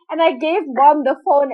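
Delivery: ordinary talk, nothing marked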